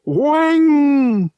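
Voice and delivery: high and loud creature cry; alien Togorian exclamation. Acted. Togorian copilot echoing the blast on the hull, loud alien cry. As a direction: bark the noise like an alien cat.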